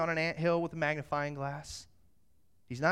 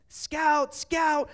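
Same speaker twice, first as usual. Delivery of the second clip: high, loud calling voice; imitated dog call; 10 semitones higher, 7 dB louder than usual. Sister-in-law getting close to her runaway dog, high mock calling. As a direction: call the dog's name high and urgently.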